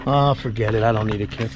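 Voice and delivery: normal talking voice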